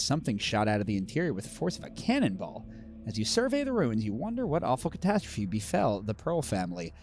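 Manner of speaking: eerie storybook narration; lightly dramatic reading of a haunted ruin; story narration. A narrator's reading. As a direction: read it like a ghost story by the fire. Reading the scenario flavor text.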